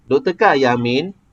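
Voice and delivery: "Doktorkah Ayah Amin" with "Amin" rising; question intonation. Shown as a demonstration. Teacher showing how it becomes a question, question intonation. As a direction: raise "Amin" at the end of the question. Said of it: "kalau kita tak tinggi perkataan Amin".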